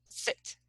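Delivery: "sit" with a short, quick i; short vowel. Shown as a demonstration. Teacher demonstrates a shorter sound, short i in "sit". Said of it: "and this is shorter".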